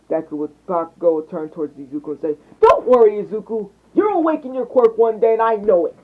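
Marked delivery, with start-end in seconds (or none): 2.6-6.0 put-on higher, louder upbeat kid voice; rally a friend with eager confidence; pitched-up pep talk; encouraging friend. A narrator's reading. Boy cheers up friend in story.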